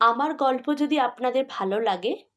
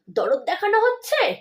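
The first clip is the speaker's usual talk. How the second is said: higher mocking jab; sneering; 10 semitones higher, 4 dB louder than usual. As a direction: scoff at his show of concern. Wife sneers at husband's care.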